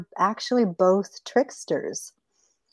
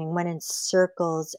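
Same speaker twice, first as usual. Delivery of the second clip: usual speaking voice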